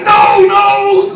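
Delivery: very high, loud, stretched wail; hammed-up panicked objection; mock protest shout. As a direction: cry out like an outraged crowd. Acts out crowd protesting.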